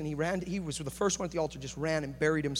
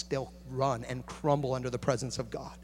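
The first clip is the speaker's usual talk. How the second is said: ordinary talk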